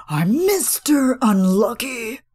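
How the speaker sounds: "Mister Unlucky" with a light imitated hoarseness and leaning on "Mister"; mock-gritty, emphatic "Mister"; hoarse emphasis. Vlogger imitating Jeff's hoarse, emphatic line. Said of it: "he just brings that hoarseness".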